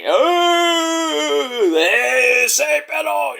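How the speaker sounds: high, long drawn-out mocking howl; Eddie Guerrero. Wrestler trying to be like a famous wrestler, high drawn-out imitation. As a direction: stretch it out high in a mock impression. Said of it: "trying to be like Eddie Guerrero".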